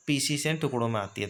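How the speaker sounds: ordinary talk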